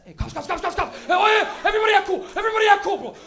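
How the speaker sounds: high loud panicked warning; panicked alarm. Retold or imitated. Guy in front seat warning friends, loud panicked shout. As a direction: yell it as the cops appear. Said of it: "You just talking shit".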